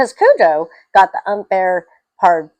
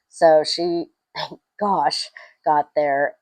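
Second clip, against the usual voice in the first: ordinary delivery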